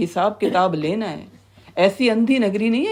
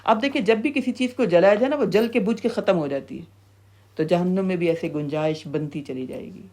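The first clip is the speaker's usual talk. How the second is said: ordinary delivery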